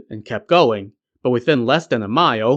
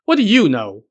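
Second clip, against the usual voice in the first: higher-pitched, dismissive retort; scoffing; 6 semitones higher, 3 dB louder than usual. A narrator's reading. Wukong answering his mocking brother, scoffing. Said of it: "Wukong scoffed".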